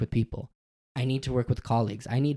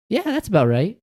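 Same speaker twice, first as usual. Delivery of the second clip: voiced human reply; 8 semitones higher, 6 dB louder than usual. Joke TikTok, human answers alien.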